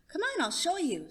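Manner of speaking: put-on a kid leading grandma by the hand; eager child voice.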